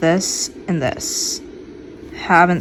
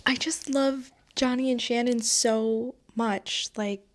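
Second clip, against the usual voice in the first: gushing softly; 3 semitones higher, 6 dB quieter than usual. Real, not acted. Gushing over favorite characters.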